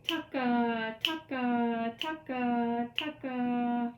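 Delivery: brisker chant, quick TA into held KA. Shown as a demonstration. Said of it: "A little faster".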